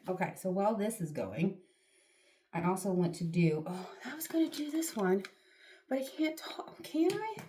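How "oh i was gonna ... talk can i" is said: higher whisper rising to a squeak; half whisper in dismay, pitch climbing; sudden catch that she cannot talk with the mask; whispered realization. Real, not acted. Realizes mask stops talking.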